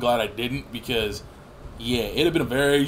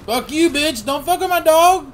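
high, loud angry yelling; furious shout; 12 semitones higher, 8 dB louder than usual. Real, not acted. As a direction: scream at the enemy for hurting the dog. Defending his dog in game.

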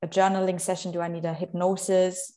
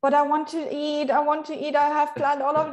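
higher, pleading voice of her hungry mind; hungry mind protesting; 6 semitones higher than usual. Retold or imitated. Her mind protesting the fast, in a higher pleading voice. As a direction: plead like a mind that wants to eat. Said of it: "So my mind goes".

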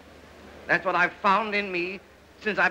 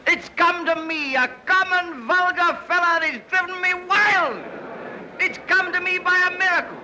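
exultant boast; 9 semitones higher than usual. Acted. Boasting of his new power.

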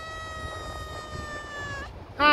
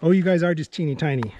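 fast, lower-pitched coo; 6 semitones lower, much faster than usual. Real, not acted. Owner cooing over tiny animals, fast and low. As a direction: rattle it off fondly.

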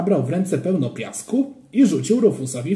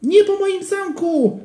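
put-on high, slow wailing child; desperate child cry; 5 dB louder, slower than usual. A narrator's reading. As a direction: wail to protect your castle. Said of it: "krzyknął Joachim". (wysoki, rozpaczliwy krzyk dziecka)

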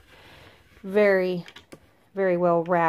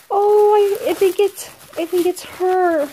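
much higher and louder, breathless delight; thrilled squeal; 11 semitones higher, 7 dB louder than usual. Real, not acted. Vlogger recognising the mouse from Instagram, thrilled squeal. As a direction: squeal as the gift is revealed.

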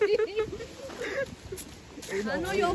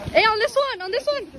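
ordinary talk, nothing marked

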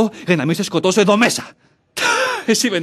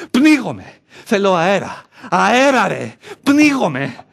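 higher, louder desperate cries for air; panicked shouting; 5 semitones higher, 4 dB louder, slower than usual. Acted.

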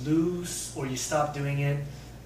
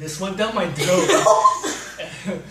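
slow, half-choked laughing complaint; laughing and choking; 5 semitones higher, 12 dB louder, much slower than usual. Real, not acted. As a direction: gasp it out through laughter.

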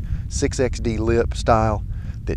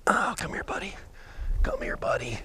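coaxing whisper; 9 dB quieter than usual. Real, not acted. Coaxing hooked fish to boat.

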